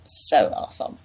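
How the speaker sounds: mock despair whisper. Mock self-pity, laughing at herself.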